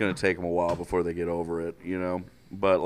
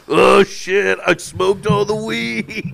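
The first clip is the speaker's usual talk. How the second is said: panicked stoner; 11 semitones higher, 6 dB louder, slower than usual. Acted. Voicing Luka in a skit.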